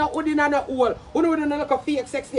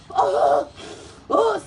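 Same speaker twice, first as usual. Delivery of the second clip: ordinary delivery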